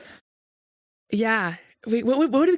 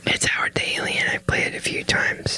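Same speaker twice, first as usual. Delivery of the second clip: whispering. Real, not acted. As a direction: keep it to a close whisper. Whispered bit continues.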